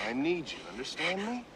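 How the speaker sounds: normal talking voice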